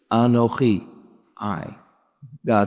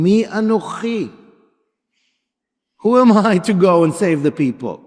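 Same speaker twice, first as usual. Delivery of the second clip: higher, pleading, doubting himself; anxious Moses; 9 semitones higher than usual. Acted. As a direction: plead like a frightened shepherd. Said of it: "And then he says".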